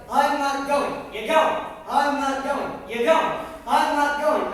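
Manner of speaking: loud, slow stubborn shouting; yelling. Retold or imitated. Husband yelling at his wife that he won't go. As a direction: bellow a flat refusal. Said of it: "He starts with the yelling".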